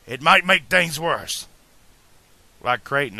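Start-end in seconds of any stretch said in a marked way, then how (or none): 0.1-1.5 higher, louder warning; admitting. A narrator's reading. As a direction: warn him emphatically. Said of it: "Doc admitted".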